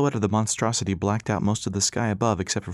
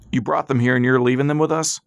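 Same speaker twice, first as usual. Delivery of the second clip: louder, higher angry protest at the soldier; indignant outburst; 4 semitones higher, 6 dB louder than usual. A narrator's reading. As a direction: throw the accusation right back at him. Pop confronts armed soldier.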